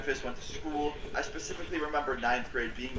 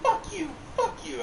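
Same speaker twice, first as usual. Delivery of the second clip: put-on very high squeaky kid's curse. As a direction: squeak it like a young kid, half laughing. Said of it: "My voice was squeaky".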